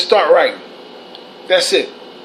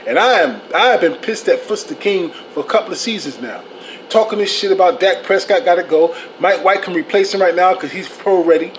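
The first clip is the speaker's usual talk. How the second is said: ordinary talk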